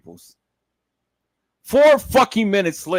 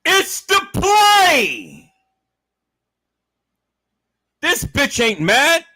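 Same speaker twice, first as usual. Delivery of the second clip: raised, high shout; shouting at viewers; 7 semitones higher than usual. Real, not acted. Vlogger yelling at viewers to see the play. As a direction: yell at them to wake up.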